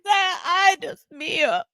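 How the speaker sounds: high, drawn-out mock lament; telenovela wailing. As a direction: wail it slowly like a soap-opera diva. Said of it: "wailing again like a telenovela".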